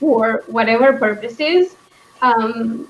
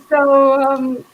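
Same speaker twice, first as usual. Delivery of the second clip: normal talking voice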